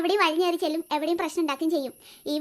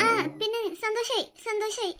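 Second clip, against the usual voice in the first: grudging sarcasm; 3 semitones higher, 5 dB quieter than usual. Acted. Forced sarcastic reply.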